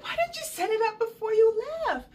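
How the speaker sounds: high, loud comic indignation; high-pitched mock outrage; exasperated complaint. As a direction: re-enact impatient griping, pitch way up. Retold exasperation at the slow nurse.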